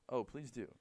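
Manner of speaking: dry, understated sarcasm; sarcastic. Acted. Teacher answering a student in chemistry class, very sarcastically. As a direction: fake an invitation with quiet sarcasm. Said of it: "He said very sarcastically".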